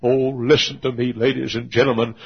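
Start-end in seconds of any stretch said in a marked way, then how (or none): none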